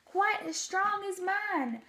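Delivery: an anxious elephant asking the mayor; Horton calling back. A narrator's reading. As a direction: Horton checking on the Whos, high and urgent. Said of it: "And Horton called back".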